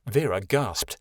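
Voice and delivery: ordinary delivery, nothing marked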